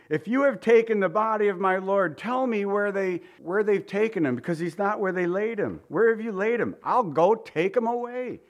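high, pleading Mary; heartbroken. Acted. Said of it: "she's heartbroken".

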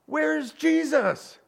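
high, slow, put-on asking voice; mock innocent question. Pizza man asks the shop lady, high mock-innocent. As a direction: ask it slow and high, baiting her.

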